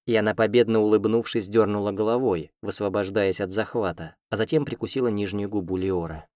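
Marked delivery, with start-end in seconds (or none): none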